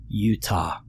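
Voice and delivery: deadpan and quiet; a deadpan, falling Utah; straight face. A narrator's reading. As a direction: say it dead straight. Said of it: "he said that with a straight face".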